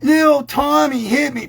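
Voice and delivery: put-on high, loud tattling child voice; mock child tattling; whiny kid. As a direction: whine like a little kid telling on a friend. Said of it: "they keep coming over to you going".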